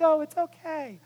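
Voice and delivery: high, mocking girlish lilt; unworried teenage girl. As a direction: mimic a blithely calm teenager. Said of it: "they're like".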